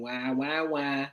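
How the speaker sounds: low, drawn-out mock whine; mock whining. Co-host mimicking P Dollar's catchphrase, mock whining. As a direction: imitate someone's habitual whining. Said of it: "why does he be whining".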